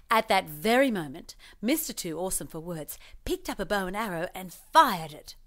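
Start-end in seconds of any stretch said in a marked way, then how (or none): none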